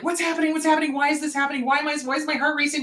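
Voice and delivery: high, hurried worried questions; mock panic. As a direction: run the anxious questions together without a break. Voices panicked racing thoughts.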